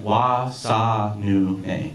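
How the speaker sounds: very slow, syllable by syllable; careful repetition. Retold or imitated. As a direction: sound out each syllable like a learner. Repeating the newly taught phrase carefully.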